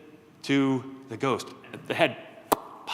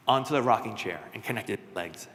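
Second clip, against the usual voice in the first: ordinary delivery, nothing marked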